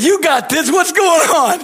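real laughter bubbling through the friend's line; laughing. Real, not acted. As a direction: quote the friend while cracking up. Friend quoted while laughing.

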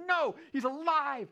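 loud, high, drawn-out cry; emphatic insistence. Acted. Mary answering the scoffing disciples, emphatically insistent. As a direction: stretch it out, insisting at full voice.

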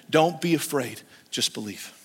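low, soft comfort; Jesus calming a frightened father, soft and low; gentle Jesus. Retold or imitated. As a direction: hush the father's fear.

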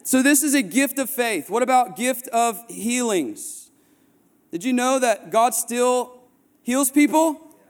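high, driving sermon voice; rising preacher's fervor; preaching at full force. Acted. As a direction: preach it out to the whole room. Preaching healing testimonies.

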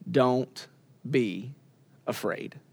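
low, hushed, word by word; gentle quote. Retold or imitated. Jesus reaching down to comfort fallen John, gentle and hushed.

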